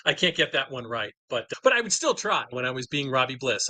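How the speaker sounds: ordinary talk, nothing marked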